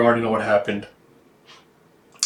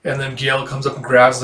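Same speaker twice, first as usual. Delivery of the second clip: ordinary delivery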